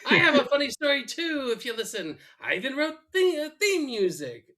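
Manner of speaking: high eager pleading voice; pleading Frink. Retold or imitated. Frink begging to tell his story, high and eager. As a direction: beg them to listen.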